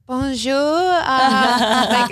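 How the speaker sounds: high, drawn-out bonjour; hammed-up greeting. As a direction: stretch out a mock tourist greeting. Retelling greeting to French security guards.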